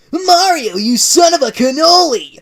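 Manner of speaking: high, loud furious yell; angry shout. Acted. Sonic bursts in at Mario, furious shout. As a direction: bellow it across the store. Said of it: "shouted an angry voice".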